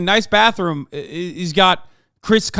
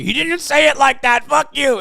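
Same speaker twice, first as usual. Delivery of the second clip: mock chatter; 9 semitones higher, 6 dB louder than usual. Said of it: "people in here being like".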